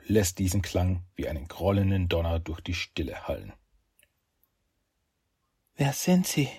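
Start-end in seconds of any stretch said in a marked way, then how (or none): 5.8-6.5 high, frightened woman's question; trembling voice. Acted. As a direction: ask it with a trembling voice. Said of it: "mit zitternder Stimme".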